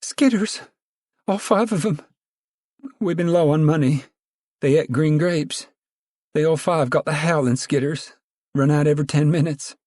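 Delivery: high bleating plea. A narrator's reading. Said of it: "The confessor bleated".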